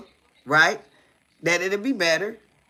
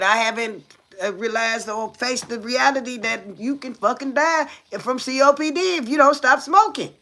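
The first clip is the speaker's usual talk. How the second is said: normal talking voice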